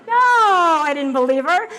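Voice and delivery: loud, high NO with a laugh; emphatic no. Real, not acted. Telling the audience she doubted the supplements, loud laughing. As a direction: belt out the denial.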